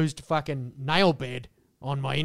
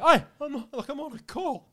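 higher-pitched, put-upon objection; protesting bloke; 9 semitones higher than usual. Retold or imitated. Bloke on the bench objecting to being interrupted, protesting.

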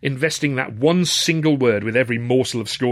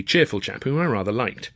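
ordinary delivery, nothing marked

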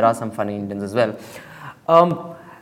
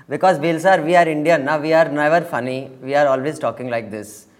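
a light, playful put-on Indian English accent; lightly lampoon an Indian English accent; mock Indian accent. Host mocking Bill Maher's view of Indians, in a put-on Indian accent. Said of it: "I can't talk in an accent too long".

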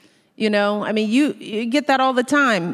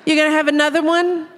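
fast, higher prying chatter; lightly mocking intrusive asker; mock nosy question; 6 semitones higher, 3 dB louder, faster than usual. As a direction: fire off questions like a busybody aunt. Mocking nosy people after first kid.

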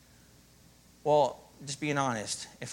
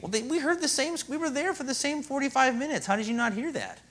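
high, put-on puzzled voice; mock indignant listener; 11 semitones higher than usual. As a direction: play the baffled churchgoer. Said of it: "And you think".

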